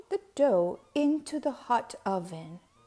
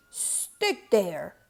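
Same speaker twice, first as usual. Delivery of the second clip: yelled; 4 semitones lower than usual. A narrator's reading. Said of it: "he yelled at the dough".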